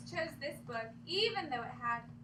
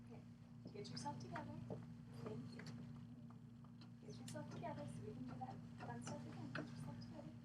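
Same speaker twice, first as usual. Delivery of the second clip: soft calming voice; 14 dB quieter than usual. Real, not acted. Calming the class.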